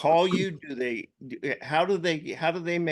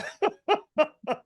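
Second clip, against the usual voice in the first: high laugh. Real, not acted. Big laugh after praise.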